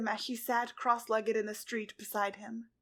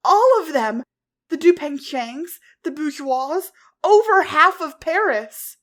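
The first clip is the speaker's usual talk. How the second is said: higher, loud angry yell; furious shout; 5 semitones higher, 15 dB louder than usual. A narrator's reading. Ladybug raging at Cat Blanc over the dead, furious shout. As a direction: let the anger explode. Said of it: "She could no longer control her anger".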